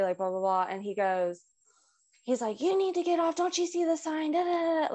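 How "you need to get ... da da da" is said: retold going off. Angry neighbor telling driver to move, high-pitched mock tirade. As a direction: imitate an angry neighbor in a higher voice. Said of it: "Like, he's going off".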